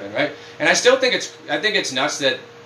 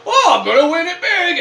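high, loud mock gambler boast; 13 semitones higher, 6 dB louder than usual. Mocking casino-goers, high and loud bragging. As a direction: ham up a cocky gambler's boast. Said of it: "are just gonna go".

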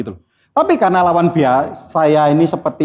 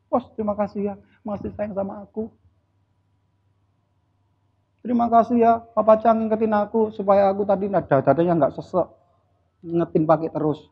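mock grateful employee; 3 semitones higher, 6 dB quieter than usual. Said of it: "berarti programnya beda".

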